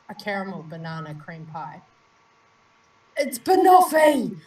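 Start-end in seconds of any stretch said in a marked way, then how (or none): 3.2-4.4 loud, high, slow triumphant cry; triumphant shout. Real, not acted. Host guessing the flavour, triumphant shout. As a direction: crow it like you solved it.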